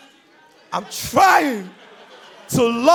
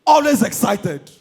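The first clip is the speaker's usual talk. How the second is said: ordinary talk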